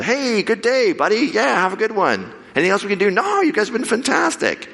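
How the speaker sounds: high, chummy farewells; cheerful and pitched high. Retold or imitated. Cheerful goodbyes after resolution.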